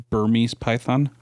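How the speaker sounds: normal talking voice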